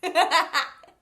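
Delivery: loud high laugh; real laugh bursting out; burst of laughter. Real, not acted. Laughs at fly interruption.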